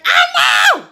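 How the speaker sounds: high, loud outburst; anguished shout. Retold or imitated. Tasha to her mother over the wrong cake, shouting. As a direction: burst out in a cry of frustration.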